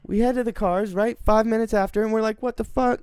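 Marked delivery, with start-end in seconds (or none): 2.4-3.0 high, shocked what the fuck. Retold or imitated. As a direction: react in disbelief. Shocked retelling.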